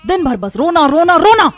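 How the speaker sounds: rising to a loud, high exasperated yell; angry scolding. Acted. Mother yelling at her crying baby, exasperated shout. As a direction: build the irritation into a shout. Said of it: "Avani गुस्से में ... डांटने लगती है".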